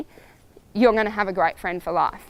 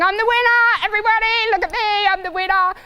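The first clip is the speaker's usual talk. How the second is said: much higher, loud, crowing horse voice; screaming stallion; 10 semitones higher, 3 dB louder than usual. Retold or imitated. Stallion at prize giving, high loud crowing brag. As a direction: crow your victory to the crowd. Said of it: "screaming and piaffing and passaging".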